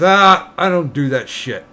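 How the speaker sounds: a lightly voiced miner brushing it off; miner in a joke.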